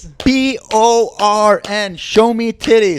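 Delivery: high, slow, emphatic chant; hammed-up demand. Friend clamouring for VR porn, hammed-up chanting demand.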